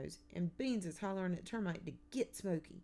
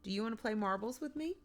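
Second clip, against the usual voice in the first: put-on quick, higher little-boy invitation; eager interjection; 6 semitones higher, 4 dB louder, faster than usual. A narrator's reading. Little boy invites new girl to play, eager. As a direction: jump in eagerly, rising at the end. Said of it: "the little boy interjects".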